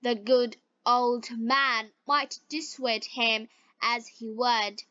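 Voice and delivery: usual speaking voice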